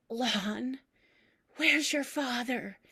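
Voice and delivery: a hint of a frail old grandmother; lightly voiced confused grandmother asking; hoarse whisper. Grandmother in hospital bed asking, lightly voiced hoarse whisper. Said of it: "Meme's hoarse whisper".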